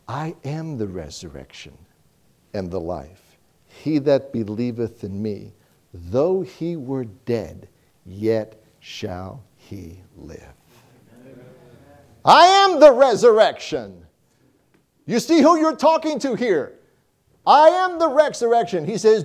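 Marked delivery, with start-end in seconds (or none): 0.1-10.5 low, slow and soft, words of Christ; solemn scripture quote. Acted. Preacher quoting Jesus to Mary, low slow reverent voice. 12.2-18.8 high, louder I am the resurrection; ringing proclamation. Acted. Preacher proclaiming Christ's words to the congregation, high ringing.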